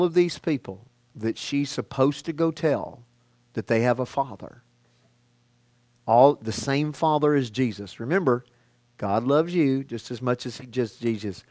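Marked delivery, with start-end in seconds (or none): none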